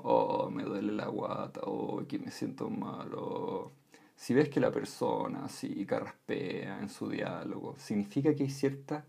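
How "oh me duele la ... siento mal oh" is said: mock groaning as if with a stomach ache, softer toward the end; rough, sick-sounding complaint. Shown as a demonstration. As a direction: moan it like you feel ill. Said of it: "como cuando te duele la guata o estás enfermo".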